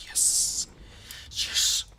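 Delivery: breathy triumphant yes; excited whispered yes. Real, not acted. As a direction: hiss a delighted yes twice.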